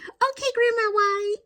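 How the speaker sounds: put-on high, sweet child answering grandma. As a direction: play the obliging little girl. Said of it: "And I was like".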